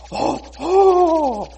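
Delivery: gasp, then high, loud oohs; mock thrilled squeal of anticipation; excited gasp. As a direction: suck in breath and coo with delight. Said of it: "And then Haman's like".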